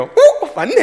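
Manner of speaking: high, loud gasp of discovery; astonished child. Retold or imitated. Preacher voicing his son seeing the prediction land, high amazement. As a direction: imitate a boy amazed it came true.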